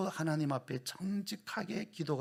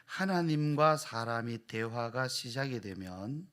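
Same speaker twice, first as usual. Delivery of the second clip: ordinary delivery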